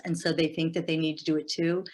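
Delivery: normal talking voice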